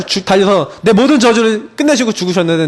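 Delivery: usual speaking voice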